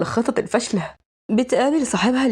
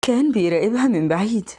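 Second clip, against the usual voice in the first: usual speaking voice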